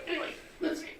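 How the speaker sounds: strained, breathless while lifting; carrying heavy load. Real, not acted. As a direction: grunt it out under the weight. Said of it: "this thing weighs a ton".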